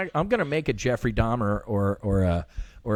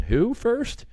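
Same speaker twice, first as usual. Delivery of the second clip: usual speaking voice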